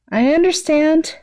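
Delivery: high, slow and sheepish; nervous quick agreement. Acted. Midoriya to his boyfriends, sheepish and nervous. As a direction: back down nervously, hands up. Sweat drop, hands held up.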